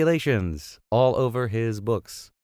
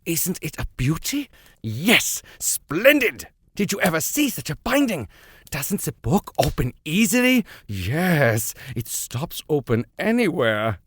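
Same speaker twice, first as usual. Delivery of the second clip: high, gushing professor showing off a book; admiring exclamations; 7 semitones higher than usual. A narrator's reading. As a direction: rave about the binding, pitch climbing. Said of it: "admiring exclamations of the professor".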